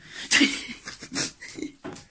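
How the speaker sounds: breathy laugh. Real, not acted. Laughing after shout.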